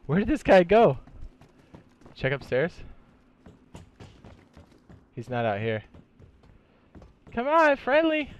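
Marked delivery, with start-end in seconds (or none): 0.1-1.0 high loud call; loud searching shout. Real, not acted. Searching for an enemy. 7.3-8.3 very high loud plea; high pleading call. Real, not acted. Pleading with a stranger.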